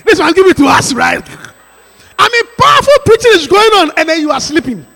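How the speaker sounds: loud, high preacher's shout; preaching at full force. Acted. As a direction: drive it home with full fire.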